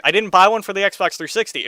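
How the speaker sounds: normal talking voice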